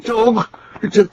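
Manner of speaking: high desperate calls; frantic calling. Acted. Boy waking from a nightmare, calling his uncle frantically. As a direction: cry out for him in fear.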